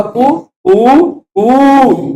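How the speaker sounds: कू short, then longer, then drawn out; rooster call demo. Shown as a demonstration. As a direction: imitate the rooster, each कू held longer. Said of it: "पहला वाला ह्रस्व होता है". (मुर्गे की तरह कू छोटा, लंबा, फिर बहुत लंबा)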